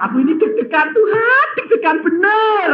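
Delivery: high, laughing-and-crying plea; nervous laughing prayer. Retold or imitated. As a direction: laugh through a jittery confession to God. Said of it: "dia bilang".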